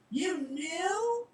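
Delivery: high, loud accusing scream; girl screaming at the reaper. Acted. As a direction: scream the question in disbelief. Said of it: "Winifred screamed".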